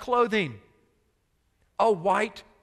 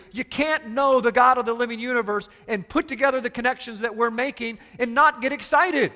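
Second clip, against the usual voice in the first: fervent preaching, pushing higher; 5 semitones higher, 5 dB louder than usual. Acted. Preacher challenging the listeners, fervent preaching. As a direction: preach it with rising fire.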